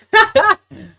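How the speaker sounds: shrill giggle burst; real helpless laughter; high laughing fit. Real, not acted. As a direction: squeal with laughter.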